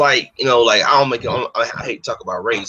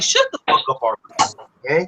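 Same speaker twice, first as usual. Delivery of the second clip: much higher, fast mock shout; shrill mock yell; 6 semitones higher than usual. Telling the rappers to shut up, in a high fast squeal. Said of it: "People like".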